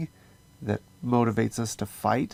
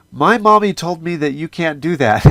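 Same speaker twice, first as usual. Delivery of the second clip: higher, louder imitation of a child; little kid scolding; 6 semitones higher, 9 dB louder than usual. Little kid corrects adults at the playground, imitated scolding. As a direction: play a tattling little kid laying down the rule. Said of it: "this little kid comes up to us and says".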